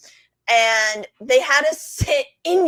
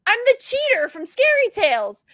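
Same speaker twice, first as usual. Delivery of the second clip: high, eager reveal; girl to haunted house actor, excited reveal; 10 semitones higher, 3 dB louder than usual. Retold or imitated. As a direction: tell him who she is with glee.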